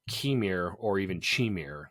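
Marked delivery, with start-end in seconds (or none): 0.1-0.7 "Chimere" said with a hard K and long ee, KEE-meer; mispronounced as read. Shown as a demonstration. Host shows how the written name looked, "Chimere" as KEE-meer. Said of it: "reading it, I thought it was". 1.2-1.9 "Chimere" said with a CH as in cheese, CHEE-meer; mispronounced as read. Shown as a demonstration. Host offers a second guess from reading, "Chimere" as CHEE-meer. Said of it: "like Q I, Chi".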